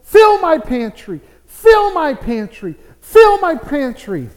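loud, high pleading to God; fervent prayer. Acted. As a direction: cry out an earnest, acted prayer. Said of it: "she kept on praying".

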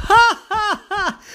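high, loud, taunting laugh; mocking pirate laugh. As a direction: laugh down at them.

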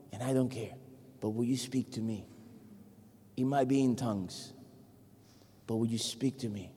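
usual speaking voice

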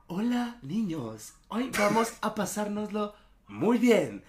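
imitating a religion teacher who wants to seem cool; trying-too-hard teacher. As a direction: greet the children like a teacher forcing the fun. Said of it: "un profesor que quiere ser buena onda".

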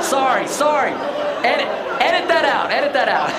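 real laughter breaking into the apology; laughing apology. Real, not acted. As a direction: laugh through an embarrassed take-back. Embarrassed at his own joke.

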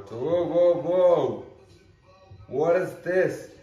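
loud, high, thrilled yelling; excited shout. Real, not acted. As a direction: shout with sudden delight at the card. Shiny card pulled from pack.

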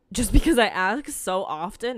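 normal talking voice

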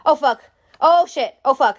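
startled doctor swearing at a tooth in a lip; shocked swearing. Retold or imitated. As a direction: blurt it out like a sweet old doctor caught off guard. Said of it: "literally goes".

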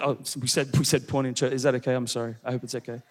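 low, soft aside; hushed apology. Real, not acted. Preacher apologizing to church for blunt word, low hushed aside. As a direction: drop right down as if sheepishly owning up.

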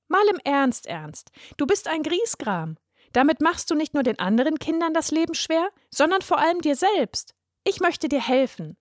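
put-on high girl's voice, kindly lecturing; a young girl trying to help a grump; high, eager girl explaining; earnest little girl. A narrator's reading.

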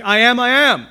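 loud, high "I am, I am"; emphatic repetition. Shown as a demonstration. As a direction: punch both halves of "I am, I am". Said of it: "say it in that language with emphasis".

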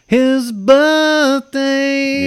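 high, loud sing-song announcement; high silly voice. Acted. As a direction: announce it in a goofy squeal.

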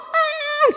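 very high acted labor scream; imitated high-pitched cry of pain. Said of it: "She started screaming".

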